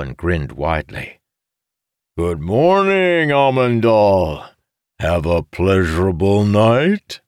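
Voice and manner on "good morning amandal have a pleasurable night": loud, high, drawn-out singsong tease; teasing greeting. A narrator's reading. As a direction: stretch it out big and high, needling a friend. Grinning character teases his friend.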